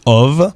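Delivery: ov ending on a buzzing v; voiced ending demo. Shown as a demonstration. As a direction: say ov with the final v voiced. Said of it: "O accent circonflexe V".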